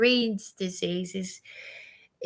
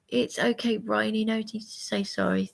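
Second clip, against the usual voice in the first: ordinary talk, nothing marked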